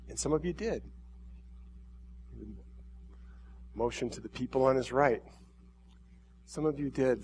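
soft, hushed, lingering words of a loving God; tender voice of God. Acted. God speaking softly to the crowd, tender and hushed. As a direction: speak as God gently calling his people. Preacher voicing God to congregation.

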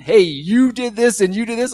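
mock accusing yell. Pastor acting out a defensive quarrel, mock yelling. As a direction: throw the blame at them, hammed up. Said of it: "it brings the guns out".

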